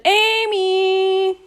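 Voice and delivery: shouted high and loud up the stairs; loud yell. A narrator's reading. Brother yelling up the stairs to wake Amy, loud shout. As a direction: bellow her name as loud as you can. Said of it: "yelled as loudly as he could".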